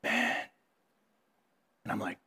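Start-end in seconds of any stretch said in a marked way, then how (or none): 0.0-0.3 still small voice. Retold or imitated. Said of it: "a still small voice speak audibly".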